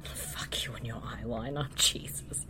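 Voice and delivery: hushed whispered insult; whispered echo. Pretend. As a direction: breathe it back as a whisper.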